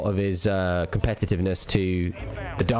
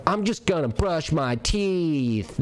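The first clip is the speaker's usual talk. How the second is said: higher, slow, drawn-out morning line; mocking dude voice; 6 semitones higher, much slower than usual. Streamer imagines the rival waking up, drawn out and mocking. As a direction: drag it out like an annoying streamer. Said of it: "was like".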